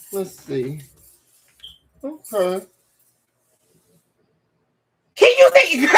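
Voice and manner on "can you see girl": excited squeal. Real, not acted. Laughing at herself on live camera.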